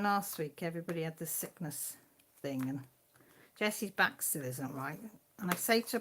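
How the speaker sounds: usual speaking voice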